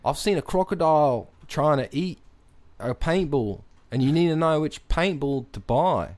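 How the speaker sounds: hammed-up Aussie know-it-all; Australian accent. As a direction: lecture in a put-on Australian accent. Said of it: "you've sustained this accent".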